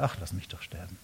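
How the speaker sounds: soft, low, defeated; resigned sigh. Retold or imitated. Elia giving up before God, a soft low resigned murmur. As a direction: let it drop, all fight gone. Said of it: "Und jetzt sagt er".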